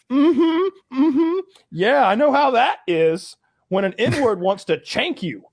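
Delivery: high-pitched, humouring hums and nods; mock agreeing along. Humouring cellmate while sitting there.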